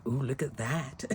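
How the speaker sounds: soft awed murmur at her own work; hushed admiring ooh. Retold or imitated. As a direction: murmur it to yourself, impressed. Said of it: "I was like".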